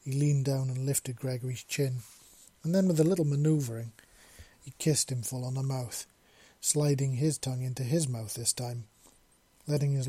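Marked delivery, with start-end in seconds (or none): none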